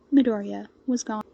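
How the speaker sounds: ordinary delivery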